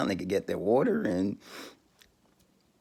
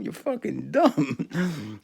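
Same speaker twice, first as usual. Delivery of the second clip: You're fucking dumb drawn out very slowly; slow, mocking You're fucking dumb; stretched-out insult; 5 semitones higher, much slower than usual. Real, not acted.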